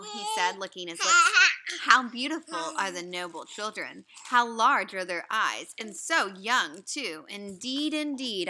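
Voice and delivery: put-on reading a story aloud to children; lively read-aloud to a class; story narration. A narrator's reading. Teacher reading the story aloud to the class.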